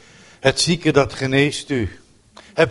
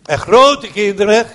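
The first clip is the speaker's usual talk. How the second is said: amused saying; 5 semitones higher, 6 dB louder, slower than usual. Real, not acted. Preacher's saying, half laughed.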